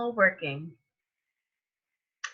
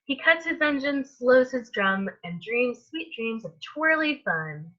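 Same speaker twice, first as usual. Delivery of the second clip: ordinary delivery, nothing marked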